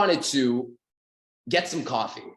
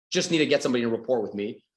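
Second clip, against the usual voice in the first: usual speaking voice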